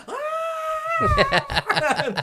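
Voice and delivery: very high, loud mock shriek; piercing imitation of screaming girls; imitated scream. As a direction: scream like startled teenage girls. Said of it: "All of them were just screaming".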